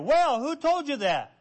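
mock indignant question. Pastor questioning gossip.

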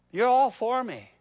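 ordinary talk

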